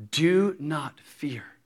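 very slow, breath-heavy reassurance; hushed, deliberate and firm; solemn command. Acted. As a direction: stretch out each word with weight. Jesus steadying Jairus, slow hushed command.

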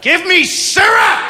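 high, slow, drawn-out demand; angry toddler. Acted. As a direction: demand it as a furious three-year-old. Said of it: "she's a little ball of anger".

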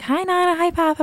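put-on high, sing-song children's hello; kids greeting. Mother imitating her kids greeting grandparents on FaceTime, high chirpy. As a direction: chirp it like little kids on a video call.

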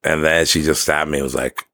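normal talking voice

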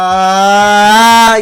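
un grito imitado que suena lejano; grito fuerte, como venido de lejos; distant scream. Retold or imitated. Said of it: "Oigo de lejos". (grito lejano imitado)

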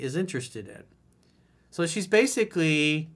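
normal talking voice

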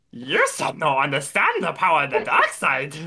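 goofy Gungan voice turned menacing; Jar Jar voice. Pretend. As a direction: play Jar Jar as a dark lord. Jar Jar as Sith.

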